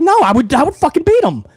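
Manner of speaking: imitating pissed-off Logan. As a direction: play a riled-up guy swearing he'd win. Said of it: "getting pissed at his buddies".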